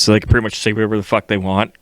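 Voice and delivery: usual speaking voice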